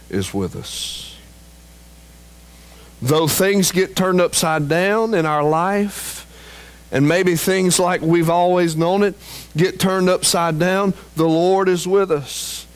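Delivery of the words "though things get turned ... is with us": higher, driving preacher's build; fervent preaching. Acted. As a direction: push it up with conviction.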